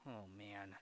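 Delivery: low, hushed mock sigh; imitated emotional sigh. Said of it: "of an emotional sigh from Jumba".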